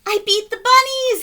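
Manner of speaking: very high and loud cheer; whooping. Acted. McGreely celebrating beating the bunnies, whooping. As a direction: whoop with victory. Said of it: "he whooped".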